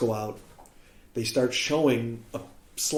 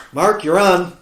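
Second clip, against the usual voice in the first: calling out; 6 semitones higher, 9 dB louder, slower than usual. Retold or imitated. Host calling him to stage.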